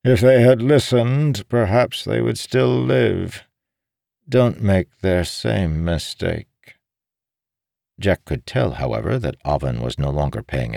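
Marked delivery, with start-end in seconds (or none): 0.0-6.8 raised pitch, measured warning; lightly put-on higher voice for the old man; slow down into a pointed warning; lightly voiced captive old man. Voices captive old man's speech.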